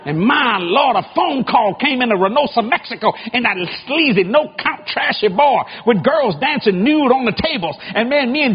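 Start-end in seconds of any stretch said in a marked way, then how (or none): none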